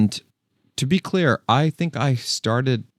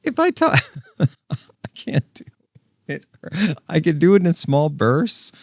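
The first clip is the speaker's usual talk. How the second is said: high, strained falsetto breaking into a laugh; high voice with laughter; 9 semitones higher, 4 dB louder than usual. Shown as a demonstration. Vlogger straining his high voice for the dog, laughing. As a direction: push the squeaky voice until it cracks. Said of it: "talking like that hurts my voice".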